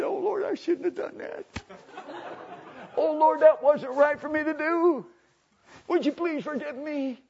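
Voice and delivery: high-pitched mock crying prayer; imitated weeping. Preacher acting the convert begging God's forgiveness, in high imitated weeping. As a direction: wail it like a tearful penitent, hammed-up. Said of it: "begins to weep and cry and say".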